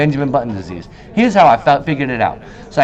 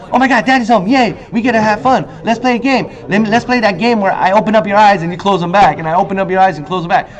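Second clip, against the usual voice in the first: put-on higher, excited child voice; hyped-up kid; 6 semitones higher than usual. Kid greeting dad at the door, higher and excited. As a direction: bounce on it like an eager kid. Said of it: "he sees his kid all hyped up, going".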